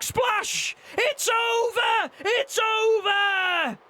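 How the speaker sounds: high, shouted title-win call; wrestling commentator. Acted. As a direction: scream the finish like a ringside announcer. Live wrestling play-by-play.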